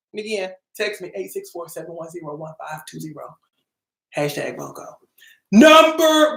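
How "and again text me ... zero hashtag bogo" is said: quiet aside. Real, not acted. Repeats number as aside.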